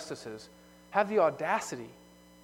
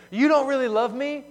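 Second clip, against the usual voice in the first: higher, louder, mimicking a sulky teen; huffy teenager; 8 semitones higher, 6 dB louder than usual. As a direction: imitate a teenager accusing a parent. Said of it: "in a huff they say".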